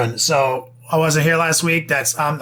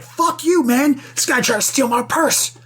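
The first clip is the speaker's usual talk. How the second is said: put-on high, indignant yell of the girlfriend; mock outrage; 9 semitones higher than usual. Girlfriend yells at the purse snatcher, high mock outrage. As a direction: yell it high, fired up and offended. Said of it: "And she's like".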